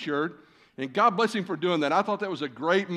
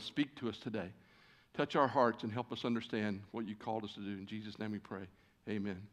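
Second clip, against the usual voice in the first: prayer; 4 semitones lower than usual. Acted. Pastor leading the congregation in prayer, reverent and hushed. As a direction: lead the church in prayer, fading toward the amen. Opening prayer before sermon.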